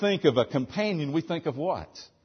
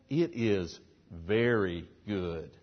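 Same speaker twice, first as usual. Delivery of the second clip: hushed, slow voicing of God; 9 semitones lower, 6 dB quieter, slower than usual.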